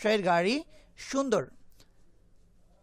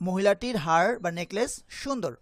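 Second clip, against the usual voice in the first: ordinary delivery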